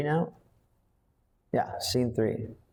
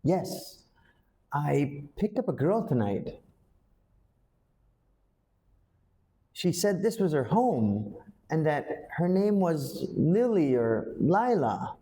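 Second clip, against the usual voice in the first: higher and halting, slowing on Lily or Lila; uncertain, can't remember; 6 semitones higher than usual. Shown as a demonstration. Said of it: "Let's try it again".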